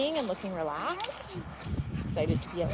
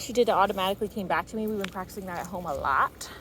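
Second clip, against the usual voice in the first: usual speaking voice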